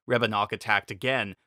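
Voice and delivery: ordinary delivery, nothing marked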